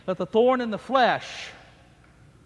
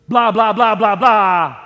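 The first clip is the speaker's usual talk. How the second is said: mocking blah; 9 dB louder than usual. Mocking the accusing voice.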